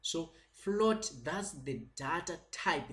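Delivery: normal talking voice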